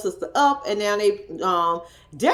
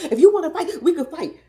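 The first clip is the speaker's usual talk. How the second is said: loud, high mock challenge; acted fighting challenge; 8 semitones higher, 6 dB louder than usual. Woman challenging her man in the car, mock combative. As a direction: throw down a challenge, raised and high.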